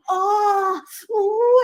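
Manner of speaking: high, loud mock strain; straining groan. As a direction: squirrel brags about the rock, mock straining groans. Squirrel mimics straining under rock.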